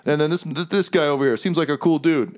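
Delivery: high-pitched, pleased fiend chattering away; high casual demon host, repeating himself happily; chipper fiend. Acted. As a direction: play a fiend delighted with his own work. Game master plays fiend Bell.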